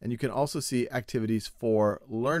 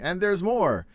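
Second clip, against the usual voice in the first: higher, louder infomercial pitchman; 7 semitones higher, 4 dB louder, slower than usual. Pretend. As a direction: sell it like a late-night TV ad. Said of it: "This is going to sound like an infomercial".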